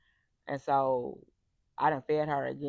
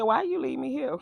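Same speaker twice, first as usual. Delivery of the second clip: high whiny plea; 9 semitones higher, 3 dB louder than usual. Retold or imitated. Imitating little sister's plea.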